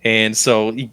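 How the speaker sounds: normal talking voice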